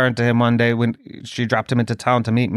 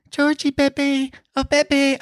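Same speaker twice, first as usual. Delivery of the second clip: very high, doting pet voice; cat-calling baby talk; 13 semitones higher than usual. Retold or imitated. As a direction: coo to a beloved cat in a high voice. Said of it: "I hate how I talk to my cat".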